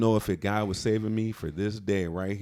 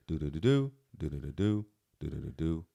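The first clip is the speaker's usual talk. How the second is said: mock suspense hum; 5 semitones lower, 5 dB quieter than usual. Builds suspense before the names.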